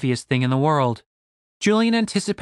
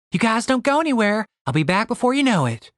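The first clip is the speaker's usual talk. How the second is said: lightly voiced, higher-pitched breezy woman; a quick, confident sign-off, pitched up a little; Stacy; 6 semitones higher than usual. Stacy leaving her companions behind, lightly voiced and breezily assured.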